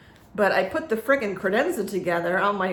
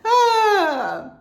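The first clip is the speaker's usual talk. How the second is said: high, loud sigh after laughter; laughing sigh; 12 semitones higher, 6 dB louder than usual. Real, not acted. Vlogger recovering from a laughing fit, high sigh. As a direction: let out a big high "ah" after cracking up.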